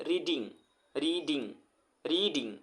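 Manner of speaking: "Reading" split into two beats, री-डिंग; "reading" repeated separately, each in two clear parts; syllable demonstration. Shown as a demonstration. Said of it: "reading दो बार में बोला जा रहा है".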